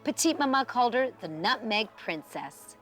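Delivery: usual speaking voice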